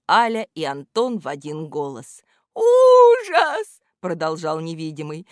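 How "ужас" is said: high, loud wail of horror; плачущий возглас. A narrator's reading. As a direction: cry it out in despair. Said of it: "в голосе у этого невидимого слышались слёзы". (громкий плачущий вопль)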